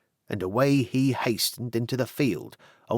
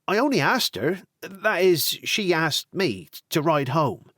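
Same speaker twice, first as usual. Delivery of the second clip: flustered son; 6 semitones higher than usual. Acted. Tony flustered to father.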